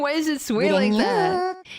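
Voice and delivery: loud, high disbelieving cry; incredulous laugh. Real, not acted. Streamer cracking up at the swaying trunk, loud and high. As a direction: shout it through the giggles.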